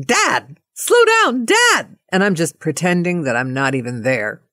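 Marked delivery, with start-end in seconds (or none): 0.0-2.0 put-on high-pitched kids' plea; daughters pleading from back seat. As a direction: imitate kids begging Dad to slow down, way up high. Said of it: "girls in the back seat are saying".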